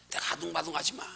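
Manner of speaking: usual speaking voice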